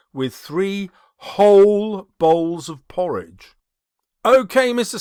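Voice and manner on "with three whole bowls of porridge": whole stretched slow and louder; stress on whole. Shown as a demonstration. Teacher showing how whole emphasizes the greed, stressed. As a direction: hit the word whole, slowly. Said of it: "emphasize it with the word whole".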